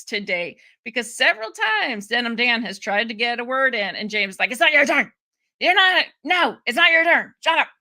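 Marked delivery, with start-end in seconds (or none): 4.5-7.7 loud, higher-pitched imitation of cutting someone off; mock scolding. Host imitating chairman silencing Denim Dan, mock scolding. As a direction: shut them down in mock exasperation.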